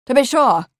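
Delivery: a hint of hearty gentleman; confident young motorist. A narrator's reading. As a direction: agree out loud, heartily. Milbank confidently agrees.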